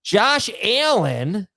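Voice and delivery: high, very drawn-out sportscaster name call; Scott Hansen impression. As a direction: stretch the name out like a TV host. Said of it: "You watch Scott Hansen on Red Zone?".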